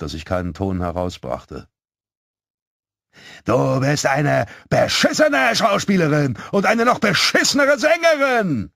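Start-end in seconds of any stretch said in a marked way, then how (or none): 3.4-8.7 put-on very high, loud jeering yell from a nasty little boy; shouted insult. A narrator's reading. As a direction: hurl the insult across the street, climbing higher. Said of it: "rief er".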